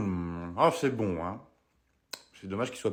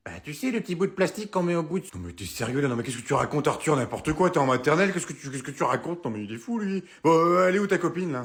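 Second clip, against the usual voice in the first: ordinary delivery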